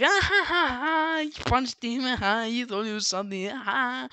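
high acted laughing jeer; mocking laughter. Kids at Pete after he punched a pirate, mocking laughter. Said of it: "they were laughing at him".

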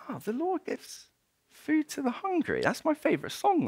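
high, hushed squeak of realisation; amazed thought to himself. Acted. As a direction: marvel softly in a high little voice. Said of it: "And he thinks to himself".

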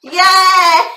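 loud happy yell; excited cheer. Real, not acted. Cheering for the viewers at sign-off.

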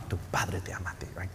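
hushed, voiceless whisper to the flock. Real, not acted. Pastor whispers the promise to his listeners, voiceless and hushed. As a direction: breathe it like a secret.

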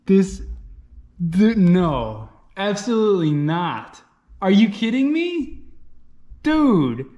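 high, excited disbelief; can't believe the pull; dawning joy. Real, not acted. Still reeling over gold stars.

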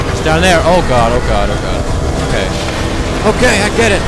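panicked, breathless urging on; being chased. Real, not acted. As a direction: run for your life, shouting. Fleeing through collapsing facility.

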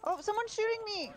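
high alarmed yelp; panicked cry. Real, not acted. Getting shot, panicked cry.

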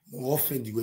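ordinary talk, nothing marked

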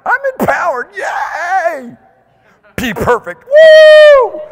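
very high, loud whoops of joy; ecstatic shouts. Acted. Preacher whooping joyfully at the congregation, ecstatic. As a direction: shout like you just won.

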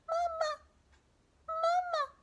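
put-on very high squeaky baby bird cries; tiny hatchling calling for mama; tiny gosling peeps. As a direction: peep it like a newborn chick.

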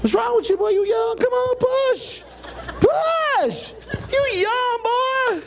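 put-on high, teasing old voice egging him on; taunting old-timer. Pastor taunting the young volunteer in a high mock voice.

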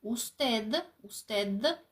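"Устед" with the final д made with tongue between the teeth; teacher's demo of "Устед", tongue between teeth on the last sound; interdental D. Shown as a demonstration. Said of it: "Дивіться, де мій язик. Він між зубками".

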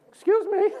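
high, squeaky cracked voice, retold; voice cracking. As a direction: attempt boldness and squeak instead. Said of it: "crack in my voice".

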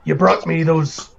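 loud, forceful horned brute; booming voice. Acted. Horned boss talking business in the booth, loud booming voice. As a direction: boom out the orders in character. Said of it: "it's a really loud, booming voice".

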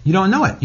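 "know" lifted high with a rising tune; emphatic repeat. Shown as a demonstration. Guest hammering his point home, "know" stressed high. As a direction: push "know" up high and let it rise.

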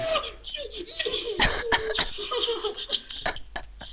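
high-pitched burst of laughter. Real, not acted. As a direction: laugh helplessly, high and falling. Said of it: "Ay, qué risa". (carcajada aguda)

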